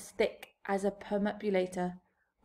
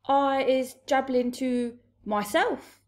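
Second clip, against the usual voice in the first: higher, slow character voice; timid giant; 5 semitones higher, 6 dB louder, slower than usual. A narrator's reading. BFG answering the towering Blood Bottler, timid giant voice. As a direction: answer the bully slowly in a raised pitch.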